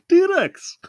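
put-on high, loud Indian-accented exclamation. Repeating the Indian dad's line for laughs, in high Indian accent. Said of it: "not letting you do an Indian accent".

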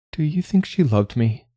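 high, tentative voice; vulnerable question. Acted. Pinyat asking Li Hu about his mother, vulnerable. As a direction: ask it as if fearing the answer.

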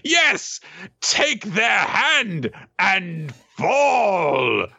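high, very slow hammed-up villain cry; gloating villain. Dosan urges the victims into the trap, gloating villain. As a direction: draw it out like a triumphant villain.

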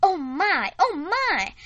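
high delighted gasp. Acted. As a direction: gush with delight. Delighted exclamation.

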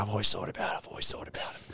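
breathy, voiceless "I've always thought about it"; mock drunken whisper. Drunk guy confessing comedy dream to comics, whispered. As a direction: confide it in a hushed whisper. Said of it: "He's like".

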